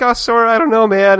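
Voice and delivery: a fretful little cricket, hammed up; high mock Jiminy whine. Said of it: "Jiminy's just kinda like".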